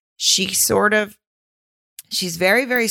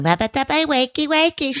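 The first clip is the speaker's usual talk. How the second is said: cutesy wake-up voice; 10 semitones higher than usual. Pretend. Mocking her cutesy morning wake-up.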